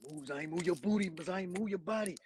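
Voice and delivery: mock urgent coaching in the ear, voiced aloud; imitating Uncle Jesse. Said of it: "he will be whispering in my ear".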